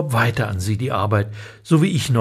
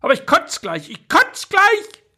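put-on high childish wail, rising far higher and slowing on the last word; hysterical child. Louis wails in the back seat, rising to a high, stretched cry. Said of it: "wurde hysterisch".

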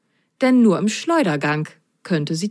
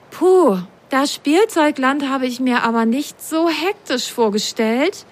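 high, lightly sighing sock; little girl voice; 7 semitones higher than usual. A narrator's reading. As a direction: sigh at the hectic station. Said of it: "bemerkte Lili".

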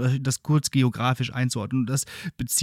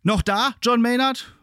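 calling out; 10 semitones higher, 4 dB louder than usual. Acted. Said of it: "durchs Sprachrohr fragt er an".

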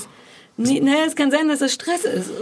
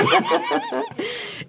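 high burst of laughter; 3 dB quieter than usual. Real, not acted.

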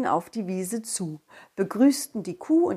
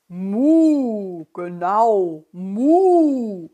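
loud drawn-out moo; cow moo; 7 dB louder, much slower than usual. Acted. Cow mooing.

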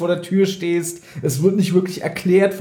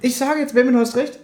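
high, fast mock concession; teasing high voice; 12 semitones higher, faster than usual.

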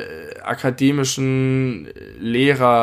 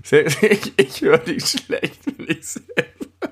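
slow, higher, teasing laugh through it; mocking echo; 5 semitones higher, slower than usual. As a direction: parrot it back, giggling.

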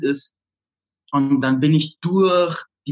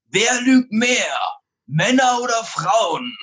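higher, slow, teasing showman delivery; slow, raised, theatrical host voice; showman's question; 6 semitones higher, slower than usual. Retold or imitated. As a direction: draw out the question like a flashy seduction coach on stage. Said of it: "und sagt so".

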